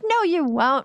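breezy retold refusal. Retold refusal to her friends.

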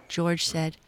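ordinary delivery, nothing marked

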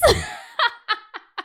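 high burst of laughter; helpless high-pitched laughing fit; real laugh. Real, not acted. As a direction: crack up at your own joke. Cracks up at the meme quote.

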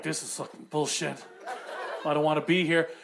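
very low, soft grumbling; sullen grumble. Acted. Unwilling woman on her phone, sullen grumble. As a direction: grumble it under your breath, fed up.

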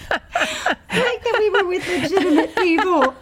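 laughing while talking. Real, not acted. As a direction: giggle through the words. Laughing through the line.

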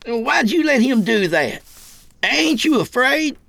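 high-pitched yelled questions. A narrator's reading. Kid questioning his locked-in keeper, yelled out. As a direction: fire the questions out high and strained. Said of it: "he yelled out".

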